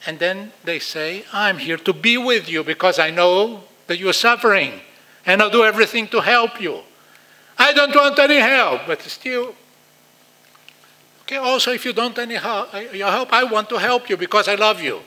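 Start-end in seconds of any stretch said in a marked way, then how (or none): 1.3-6.8 put-on louder, pressing devotion at the child; loud insistent parent. 7.6-8.8 higher, louder, pushing the parent away; child's loud refusal. Retold or imitated. Child's retort voiced. 8.8-15.0 put-on louder, pressing devotion at the child; loud insistent parent.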